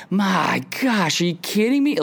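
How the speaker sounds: high-pitched mock outrage; mock disbelief. Stranded traveler hears of the delay, high mock disbelief. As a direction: throw the line up high in fake shock. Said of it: "And you're just like".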